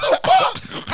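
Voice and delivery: high, loud shout of disbelief; hammed-up outraged shriek; retold freak-out. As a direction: yell it high, half laughing. Retold freak-out after tackle.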